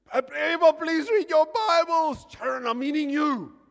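loud, high mock outburst; exasperated pleading shout. Pastor begging Sharon and congregation, mock exasperated shout. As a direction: beg them at full voice.

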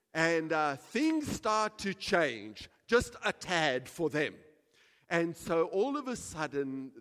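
loud, slow, emphatic storytelling; booming sermon delivery; full-force preaching. Acted. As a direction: sell the lottery windfall to the crowd.

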